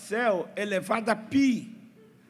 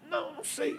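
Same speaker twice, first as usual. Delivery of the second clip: put-on whispered, much higher little-boy voice; sheepish kid; 6 dB quieter, faster than usual. Sweaty boy caught by the pastor, whispering a high sheepish excuse.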